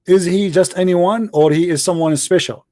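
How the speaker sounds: ordinary talk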